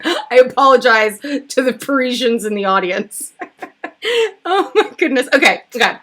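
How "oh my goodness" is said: normal talking voice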